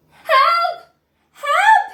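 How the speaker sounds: very high, loud yell for help. Acted. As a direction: yell desperately from the chocolate. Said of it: "he yelled".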